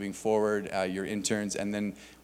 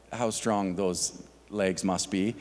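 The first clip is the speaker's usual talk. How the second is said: usual speaking voice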